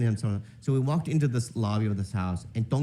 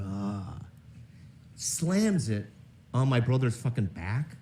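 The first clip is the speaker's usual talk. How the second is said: usual speaking voice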